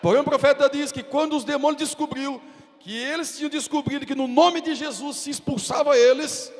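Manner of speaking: high, loud preaching shout; preaching at full force. Acted. Pastor preaching the story of Peter to the congregation at full force. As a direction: drive it home to the church.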